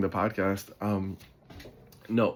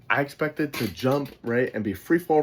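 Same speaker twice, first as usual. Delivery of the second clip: usual speaking voice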